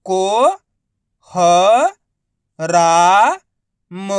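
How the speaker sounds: को, ह, रा, म each held long, with pauses; syllable by syllable. Shown as a demonstration. Teacher sounding out a word, को ह रा म drawn out. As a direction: sound out को, ह, रा, म one by one. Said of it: "direct मात्रा का उच्चारण करके".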